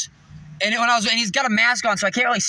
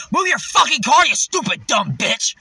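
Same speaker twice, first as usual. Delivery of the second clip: higher, louder road-rage shout; acted angry yell; 4 semitones higher, 4 dB louder than usual. Driver leaned out at the woman ahead, angry yell. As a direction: yell abuse out a car window. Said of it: "leaned out and said".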